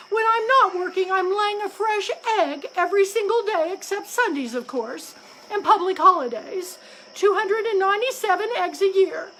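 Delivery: high, loud, weepy rant; distraught wife crow near tears; tearful complaint. A narrator's reading. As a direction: sob out the grievance. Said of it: "once again burst into tears".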